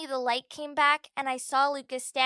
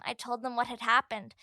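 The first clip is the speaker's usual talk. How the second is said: ordinary delivery, nothing marked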